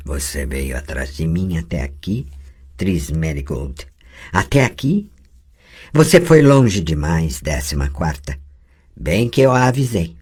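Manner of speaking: the possessing voice sneering at Tris Merigold; cold menacing voice. Acted. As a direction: taunt her with cruel scorn. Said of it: "Sua voz tornou-se metálica, fria e ameaçadora, com entonação de cruel escárnio".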